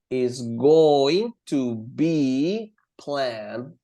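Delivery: very slow, elongated words; slow example reading. Shown as a demonstration. Teacher converting the meeting sentence for students, very slowly. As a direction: stretch out each word of the structure.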